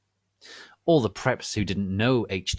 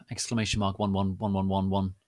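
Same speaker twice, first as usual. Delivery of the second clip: usual speaking voice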